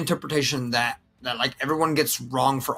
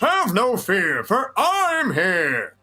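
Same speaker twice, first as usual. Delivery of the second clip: higher, very slow, throaty heroic boom; All Might with throat; 5 semitones higher, much slower than usual. Shown as a demonstration. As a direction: push it through the throat like a grand hero. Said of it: "step two includes using the throat".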